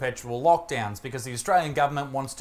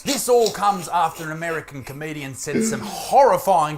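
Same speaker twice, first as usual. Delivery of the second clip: ordinary delivery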